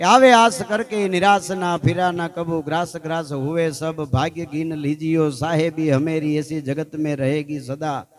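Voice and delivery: loud, rhythmic recitation of a Hindi poem; declaimed verse. Acted. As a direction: proclaim the couplets to the crowd. (જોરથી, તાલમાં કવિતા પઠન)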